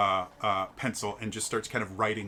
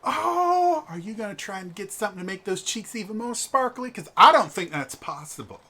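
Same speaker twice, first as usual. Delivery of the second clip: high, loud and fast; shower her with flattery; gushing noblewoman; 10 semitones higher, 7 dB louder than usual. Acted. GM voices a gushing wife.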